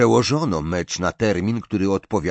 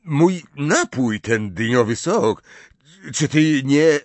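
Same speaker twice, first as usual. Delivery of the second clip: higher, halting, incredulous question; stammering, scared but excited; 6 semitones higher, 4 dB louder, slower than usual. A narrator's reading. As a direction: piece it together in nervous excitement. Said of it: "wyjąkał z miną trochę wystraszoną, ale i podekscytowaną".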